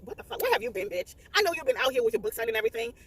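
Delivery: high, sassy calling-out of the auntie; exasperated scolding. Retold or imitated. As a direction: scold the auntie as if she were here. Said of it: "I'm like".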